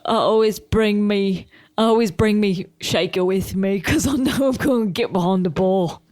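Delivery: put-on mummy's boy voice; Max impression. Son ready to tend Mummy's bar, mock-dutiful voice. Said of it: "He's like".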